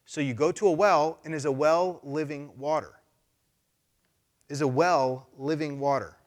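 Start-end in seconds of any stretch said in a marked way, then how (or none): 4.5-6.2 slow, deliberate rhetorical question; slow pointed question. Real, not acted. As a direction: let each word land slowly. Repeats question for emphasis.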